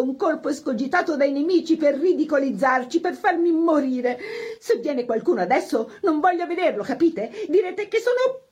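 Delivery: high, wounded outburst, climbing in pitch; distraught hostess. A narrator's reading. Humiliated hostess sends pianist and everyone away, hysterical. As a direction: dismiss everyone in rising hysteria.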